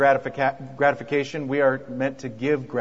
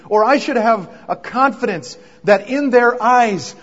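higher and louder, building passion; preaching at full force; 7 semitones higher, 8 dB louder than usual. Real, not acted. Pastor preaching to couples about grace, impassioned. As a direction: preach it out with rising fire.

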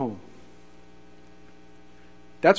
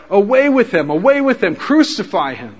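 louder, higher cries of away with him; crowd crying out; 4 semitones higher, 7 dB louder than usual. Retold or imitated. Preacher voicing the crowd demanding crucifixion, loud. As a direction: call it out like the mob.

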